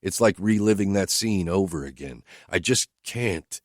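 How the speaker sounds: usual speaking voice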